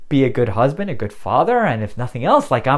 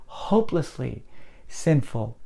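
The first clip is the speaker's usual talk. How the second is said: ordinary talk, nothing marked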